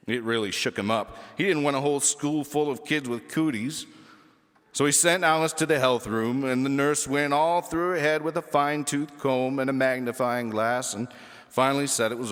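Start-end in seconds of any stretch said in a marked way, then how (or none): none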